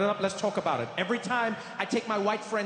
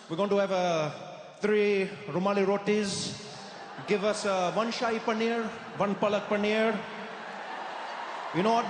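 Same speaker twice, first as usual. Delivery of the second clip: put-on Indian accent; 3 semitones lower than usual. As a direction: show off an Indian accent to the waiter. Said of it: "that's when I Trudeau'd".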